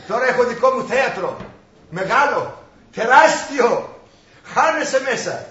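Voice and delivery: loud, building boast down the phone; proud boasting. Acted. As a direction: swell with pride, drawing out the biggest word. Boasting to mother of own theatre. (δυνατά, με καμάρι)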